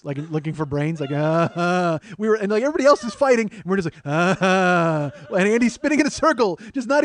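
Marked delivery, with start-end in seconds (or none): 1.1-2.0 drawn-out undead groan; zombie moan. Retold or imitated. Brothers shamble along for a laugh, slow zombie groaning. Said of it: "walked slow like zombies". 4.1-5.4 drawn-out undead groan; zombie moan. Retold or imitated. Brothers shamble along for a laugh, slow zombie groaning. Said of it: "walked slow like zombies".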